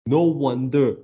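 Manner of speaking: ordinary talk, nothing marked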